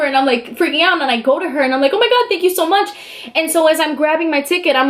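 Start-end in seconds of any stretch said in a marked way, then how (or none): 1.9-2.9 high, excited re-enacted gratitude; gushing thanks. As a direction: thank them as if freaking out. Retelling thanking judge while freaking out.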